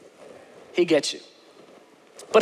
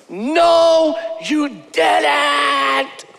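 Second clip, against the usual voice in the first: shouted denial; 6 semitones higher, much slower than usual. Retold or imitated. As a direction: shout it at God again. Scream, rage, tears.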